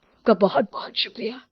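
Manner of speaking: breathy whisper of gratitude; barely voiced thanks. Father whispering thanks to the doctor, very soft.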